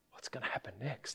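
quick, anxious-onlooker whisper; very soft, hurried whisper; hushed inner thought. Acted. Imagined thoughts of onlooking Israelites.

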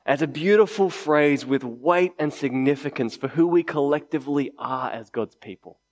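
ordinary delivery

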